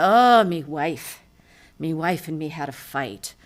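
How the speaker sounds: mournful Irish drawl, slow and loud on the "Oh"; Irish-accented sad pub sigh. Acted. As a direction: play a hangdog Irishman in an Irish brogue. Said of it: "my favorite Irish joke".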